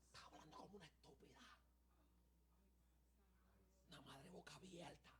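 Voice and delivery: hissed furious whisper; a heated quarrel kept to a whisper; angry whisper. Retold or imitated. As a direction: whisper the insults so no one overhears. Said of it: "bajaron tanto la voz".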